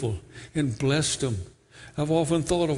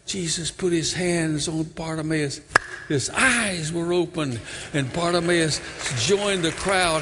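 usual speaking voice

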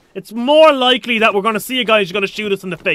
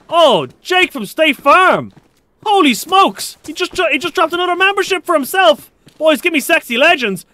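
excited shout-out; 7 semitones higher than usual. Real, not acted. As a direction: hype up the new member. Streamer thanks a donor excitedly.

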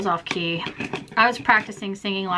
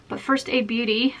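ordinary delivery

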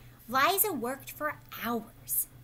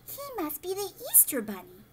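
exclaimed in wonder; 7 semitones higher than usual. A narrator's reading. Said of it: "they exclaimed in wonder".

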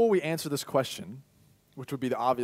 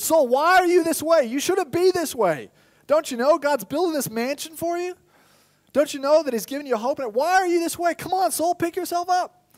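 much higher, louder urging; rally a stubborn heart, scolding; scolding pep talk; 12 semitones higher, 6 dB louder than usual. Acted. The mind shouting at the heart, urging it up. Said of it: "the mind talking to the heart".

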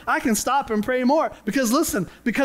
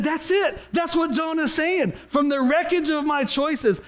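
much higher, animated re-enactment; excited realisation; 7 semitones higher than usual. Retold or imitated. Preacher reliving his discovery in the lyrics, excited realisation. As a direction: burst out as if it just clicked.

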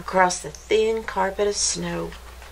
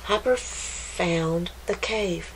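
usual speaking voice

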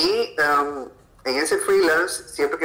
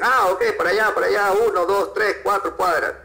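higher, eager direction-giving; imitated helpful locals; 6 semitones higher, faster than usual. Japanese locals show a lost tourist the way, imitated eager helpfulness. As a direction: point the way brightly, counting off blocks. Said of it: "y te dicen".

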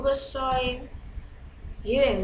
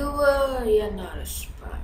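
ordinary talk

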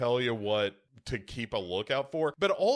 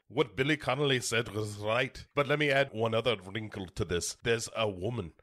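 imitated Scottish lecturer adding a point; Gerard Butler's Scottish accent. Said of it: "Legitimate Scottish accents happening".

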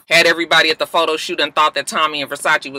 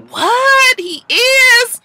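very high-pitched, exaggerated disbelief; mock shock; 4 dB louder, much slower than usual. Carly Red hears D-Lo is the pappy, mock shock. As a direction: squeal it like a gossip acting stunned.

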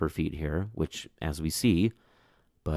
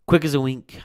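excited narration; 8 semitones higher, 8 dB louder than usual. A narrator's reading. Climax of the story.

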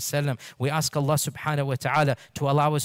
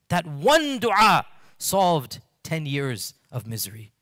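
slow emphasis; slower than usual. Acted. Sermon's emphatic punchline.

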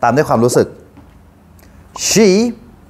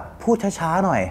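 1 soft quoted prompt; 5 semitones higher, 8 dB quieter, slower than usual. Shown as a demonstration. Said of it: "ลองบอกว่า".

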